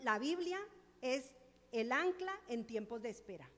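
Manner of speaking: loud, full-force preaching. Real, not acted. Preacher tells the congregation, preaching loudly. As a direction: drive the testimony home to the church.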